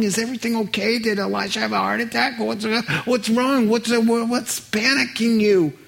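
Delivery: high-pitched, worried rush of questions; acting out someone panicked on another's behalf; alarmed questioning. Naaman climbs off the chariot asking what's wrong, alarmed. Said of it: "he thinks something's really wrong".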